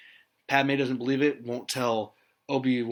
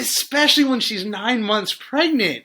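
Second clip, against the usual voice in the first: much higher and louder outburst; indignant emphasis; 9 semitones higher, 7 dB louder than usual. Real, not acted. Host objecting that she was nine months pregnant, indignant. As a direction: jump in, appalled on the victim's behalf. Indignant argument over force choke.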